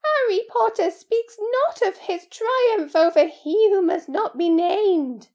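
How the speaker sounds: high, loud, slow elf voice; solemn awe, pitched right up; Dobby, reverent. Acted. House-elf praises Harry's triumph, reverent and slow.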